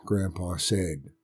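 ordinary delivery

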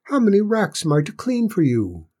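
high-pitched, eager-to-please little servant; small squeaky Cinders. A narrator's reading. Cinders asks how many racks, small high voice. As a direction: ask it high and polite.

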